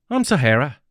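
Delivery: friendly Australian woman; Australian accent. Acted. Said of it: "also in an Australian accent".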